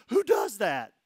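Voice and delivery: loud, high amazed cry, close to tears; amazed shout. Retold or imitated. A man moved to tears, asking the pastor loudly in amazement. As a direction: blurt it out in tearful disbelief. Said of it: "and he goes".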